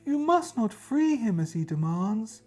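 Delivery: high otherworldly warning voice; ghostly queen. Acted. Queen's spirit warning the adventurer, high and eerie. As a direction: warn him gravely as a spirit queen.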